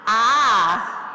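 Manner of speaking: loud open-mouthed ah; loud demo ah. Shown as a demonstration. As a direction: open wide ah, pushed out loud. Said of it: "Can't hear you".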